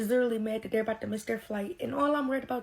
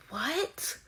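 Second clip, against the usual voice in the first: whispered disbelief; 4 semitones higher than usual. Real, not acted. Disbelief at bribe demand.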